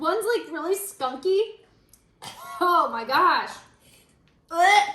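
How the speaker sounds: high, loud grossed-out reaction; disgusted. Real, not acted. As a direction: recoil from the bad taste. Disgusted by the wine taste.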